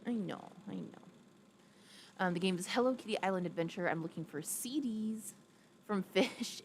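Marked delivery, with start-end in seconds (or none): none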